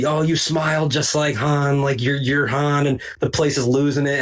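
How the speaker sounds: retold gushing, held high; mock gushing. Retells actor gushing onstage over hug.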